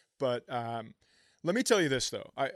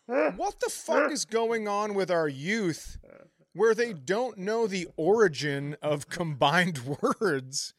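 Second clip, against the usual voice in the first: put-on incredulous, higher, slow rant at kids today; louder mock outrage at young people; mock exasperated rant; 7 semitones higher, 4 dB louder, slower than usual. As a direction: scold the youth in disbelief, drawn out. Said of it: "And I was like".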